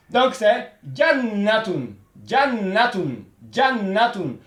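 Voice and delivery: jannatun said slowly with a long doubled n, jan-na-tun; teacher drilling jannatun slowly for students; slow model word. Shown as a demonstration. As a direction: model jannatun clearly, the n held twice as long. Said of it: "Le tchada d'abord".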